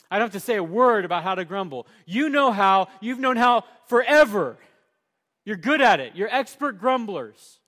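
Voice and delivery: loud, building sermon delivery; preaching at full force. Acted. Preacher calling out his grumbling congregation, loud. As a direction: drive it home to the congregation.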